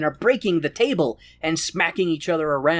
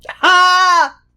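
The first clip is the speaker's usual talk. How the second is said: high loud "ha"; loud bark of laughter; 11 dB louder than usual. Real, not acted. Laughing at his wife's joke, one loud high bark. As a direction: burst out laughing.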